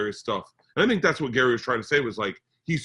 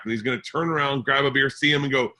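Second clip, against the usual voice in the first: ordinary talk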